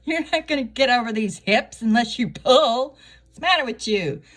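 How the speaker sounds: high, loud barking at a maid; bossy yell. Pretend. Yelling at her lady in waiting to pull harder. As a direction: yell orders at the struggling helper. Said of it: "I'd be like".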